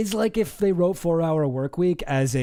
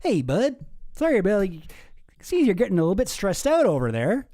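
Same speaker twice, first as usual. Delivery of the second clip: put-on rural Canadian accent; 4 semitones higher than usual. Streamer voicing Claude's text as a rural Canadian pal. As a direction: play it like a laid-back Canadian pal checking in. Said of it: "suddenly become rural Canadian?".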